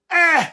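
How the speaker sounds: loud, high-pitched "eh!"; sudden shout. Real, not acted. Streamer breaks off his mocking rant, sudden high shout. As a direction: burst out with a sharp high yell.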